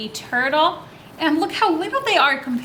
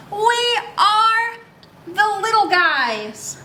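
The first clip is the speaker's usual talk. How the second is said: put-on reading to children; 4 semitones higher, slower than usual. A narrator's reading. Reads book lines to kids.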